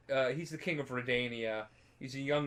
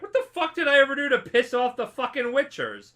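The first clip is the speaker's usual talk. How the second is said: furious mock yell, much higher and louder; angry king; 10 semitones higher, 6 dB louder than usual. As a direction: rant like an outraged king. Angry king retold in story.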